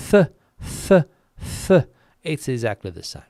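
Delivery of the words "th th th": "th, th, th" as separate loud bursts; isolated lisped "th" sounds, evenly spaced; repeated TH sound. Shown as a demonstration. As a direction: demonstrate "th" three times, spaced apart. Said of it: "the sound is exactly the same in phonetic English".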